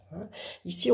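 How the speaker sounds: ordinary delivery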